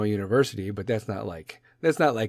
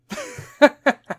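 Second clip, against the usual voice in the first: high laugh; 5 dB louder than usual. Real, not acted. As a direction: cackle at own joke. Laughs at own joke.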